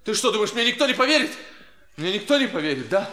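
ordinary delivery

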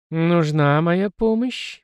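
slow, sweet honeyed question; sweet as honey, drawn out. Acted. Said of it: "медовым голосом спросила бабушка". (медовым голосом)

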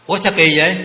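a loud puzzled "Ủa"; mock astonished question; surprised. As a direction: sound baffled and call it out. Brahmin puzzled no one cries.

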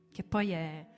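ordinary talk, nothing marked

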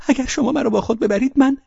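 high loud begging; desperate plea. Acted. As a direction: plead to be taken away. Girl begging Velchaninov. (التماس با صدای بلند و زیر)

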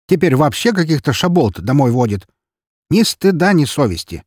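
high, loud indignant tirade; scandalised scolding. A narrator's reading. Neighbour woman shames the lad, loudly scandalised. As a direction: scold hotly, peaking then dropping at the end.

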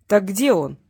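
firm, forceful repeat of the question; insistent repeat. Acted. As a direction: press the captive for an answer. Repeats question after hurting captive.

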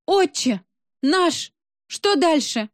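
high, drawn-out cry of prayer, turning to a desperate question; panicked wailing. A narrator's reading. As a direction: wail it like a botched exorcism. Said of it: "завопила я".

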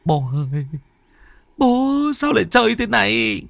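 high-pitched wail to dad; inner stammering dismay. A narrator's reading. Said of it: "Trong lòng của Kiên lắp bắp".

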